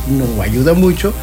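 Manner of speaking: usual speaking voice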